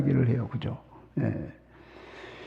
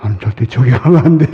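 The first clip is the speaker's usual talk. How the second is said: laughing; 4 semitones lower, 7 dB louder, faster than usual. Real, not acted. Preacher jokes about himself.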